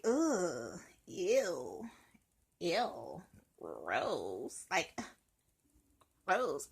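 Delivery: low, disgusted groans. Acted. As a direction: recoil in disgust. Disgusted reaction to something.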